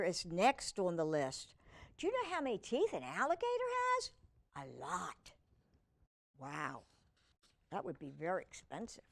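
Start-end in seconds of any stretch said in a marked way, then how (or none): 2.0-4.1 quick quiz question. Real, not acted. 4.6-9.0 lower, much softer mock-awed aside; hushed awe. Real, not acted.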